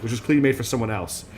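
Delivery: ordinary delivery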